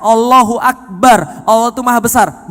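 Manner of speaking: slow, loud Allahu Akbar; fervent exclamation. Acted. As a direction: proclaim it slowly and loudly. Preacher exclaims God's greatness.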